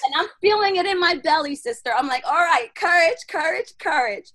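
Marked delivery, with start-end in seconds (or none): 2.3-4.3 loud, higher self-encouragement; self pep talk. Retold or imitated. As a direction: psych yourself up with pushed, repeated words. Psyching herself up in story.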